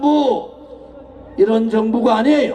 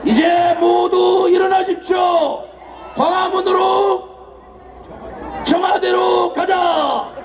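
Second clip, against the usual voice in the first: rousing call to march; 6 semitones higher, slower than usual. Acted. Calls crowd to march.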